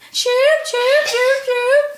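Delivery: high mock chirps; chicken sound. Friend shows what San Francisco chickens say, high chirping. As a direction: chirp brightly and absurdly high. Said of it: "this is what they say in San Francisco".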